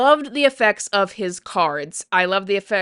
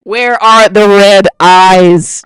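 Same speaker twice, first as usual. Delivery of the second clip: slow, very loud demand without raised pitch; emphatic roar; 13 dB louder, much slower than usual. Real, not acted. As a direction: bellow each word slowly. Repeats question, slow emphatic roar.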